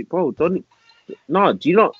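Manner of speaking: ordinary delivery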